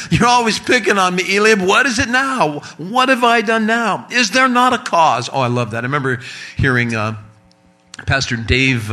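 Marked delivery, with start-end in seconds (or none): 0.1-5.3 put-on whiny kid brother. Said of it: "There's a backstory there".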